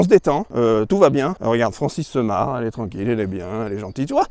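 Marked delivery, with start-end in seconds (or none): 2.6-4.3 soothing. Acted. Calming down, soothing.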